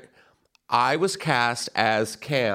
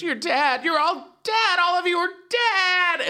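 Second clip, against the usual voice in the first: much higher, wailing doom cry; tearful warning. Retold or imitated. Sobbing moviegoer telling costumed kids they're dead, high wail. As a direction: cry out the prophecy through tears. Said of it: "she goes".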